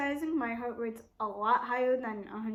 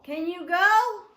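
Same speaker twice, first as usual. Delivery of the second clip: loud, high, drawn-out demand; real impatient shout, stretching the words; exasperated yell; 6 semitones higher, 9 dB louder, slower than usual. Real, not acted. Exasperated with lingering intruder.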